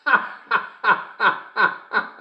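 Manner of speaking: high, long staccato laugh; uproarious laugh. Acted. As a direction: burst out high and keep laughing. Cracks up at punchline.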